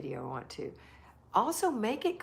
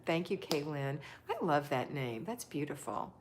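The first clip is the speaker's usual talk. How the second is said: usual speaking voice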